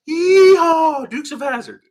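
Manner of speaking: put-on gleeful high whooping shout of a little boy; excited kid yell. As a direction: yell it like a thrilled kid spinning out on ice. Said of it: "my little brother said".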